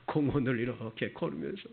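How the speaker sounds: ordinary talk, nothing marked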